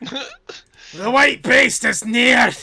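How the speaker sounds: high, drawn-out, very loud wail; panicked screaming. Acted. Dwarf warning Nolek of the dragon, panicked scream. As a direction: scream a terrified warning, stretching it out.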